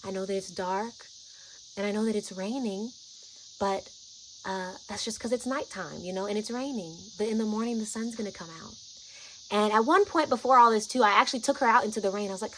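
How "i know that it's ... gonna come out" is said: soothing. Retold or imitated. Soothing her in the dark.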